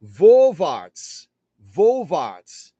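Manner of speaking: German Vorwärts; German word Vorwärts. Shown as a demonstration. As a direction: present Vorwärts as the German term. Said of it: "the V means".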